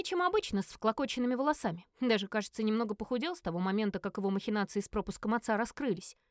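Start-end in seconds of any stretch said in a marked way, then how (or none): none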